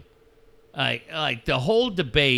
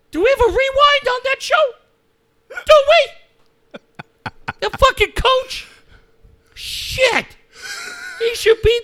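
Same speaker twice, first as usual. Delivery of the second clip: much higher, louder hammed-up squeal of outrage; mocking the coach; 6 dB louder than usual. Host mocks the coach's radio take, high loud hammed-up squeal. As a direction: shriek it like a scandalised fan.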